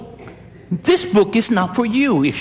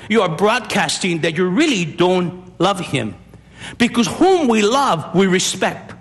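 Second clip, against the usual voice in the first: normal talking voice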